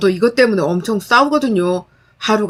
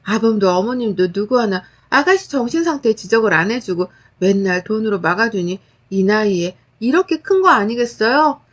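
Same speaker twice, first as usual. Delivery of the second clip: ordinary delivery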